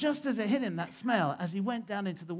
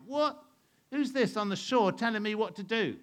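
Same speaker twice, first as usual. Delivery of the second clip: high, loud incredulous outburst; acted affront at being told; indignant disbelief; 5 semitones higher, 5 dB louder than usual. Simon Peter thinking the stranger is crazy, indignant. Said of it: "because he thinks he's crazy".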